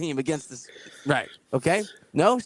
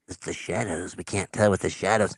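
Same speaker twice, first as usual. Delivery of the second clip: lightly voiced, lower old man excuse; old captain voice; 8 semitones lower than usual. Old captain blaming the shadows, in a lightly lowered old-man voice. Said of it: "the octogenarian captain's like".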